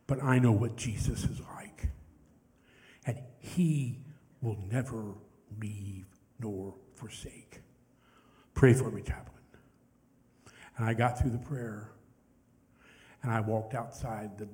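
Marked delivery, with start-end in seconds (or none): 0.1-9.4 softly voiced testimony with long pauses; hushed, reverent retelling; retold dying veteran. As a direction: quote a dying man's faith, gently and haltingly. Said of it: "he can barely whisper".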